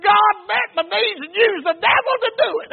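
loud, high shout of praise; jubilant shouting. Retold or imitated. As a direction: act out her triumphant shout to God. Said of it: "she started shouting again".

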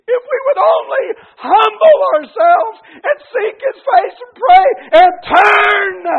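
very high, very loud, drawn-out preacher's cry; impassioned preaching shout. Real, not acted. Preacher pleading for revival, impassioned shouting. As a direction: cry it out at full volume, stretching the words.

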